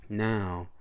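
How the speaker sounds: usual speaking voice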